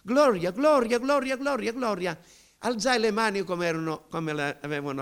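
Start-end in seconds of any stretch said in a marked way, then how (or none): 0.0-2.2 high, loud, tumbling cries of glory; re-enacted ecstatic outpouring; unstoppable praise. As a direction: let the praise burst out, word upon word. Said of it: "non potevo fare a meno".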